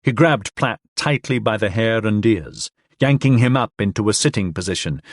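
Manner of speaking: usual speaking voice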